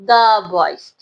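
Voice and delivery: put-on "the" said "duh" before boys. Shown as a demonstration. Teacher shows pupils how to pronounce it, "the" as duh. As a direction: open "duh" for "the", then boys. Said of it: "pronounce किस तरह से करना है".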